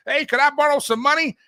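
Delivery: hammed-up, high-pitched request for cash; imitated begging relative. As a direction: pipe up like a relative after a loan. Voices dead grandparents asking for money.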